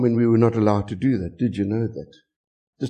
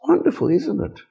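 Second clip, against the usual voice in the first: sarcastic; 13 semitones higher than usual. Real, not acted. Sarcastic remark on the lawsuit.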